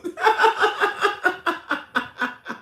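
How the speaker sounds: long, very high staccato laugh; high cackling laugh. Real, not acted. Streamer laughing after gushing, high cackle. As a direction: cackle loudly in a high, descending run.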